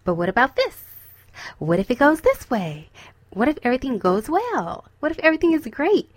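lightly voiced optimistic inner voice, lifting toward the end; hopeful true self. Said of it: "your true self is like".

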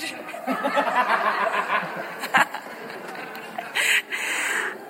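soft unvoiced laugh rising; breathy laugh. Real, not acted. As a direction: let a soft laugh slip out. Laughs at own story.

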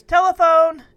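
high, loud call up the stairs. A narrator's reading. Joey announces a call from downstairs, calling out. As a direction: holler it up to someone upstairs. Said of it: "Joey called up the stairs".

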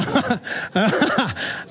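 high chuckling laugh; real laugh. Real, not acted. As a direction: crack up at your own joke. Cracks up at his own joke.

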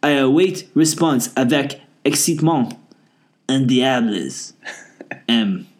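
fake French accent. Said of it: "please read my emails with the accent".

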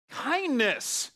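laughing. Real, not acted. Laughing repeat of key word.

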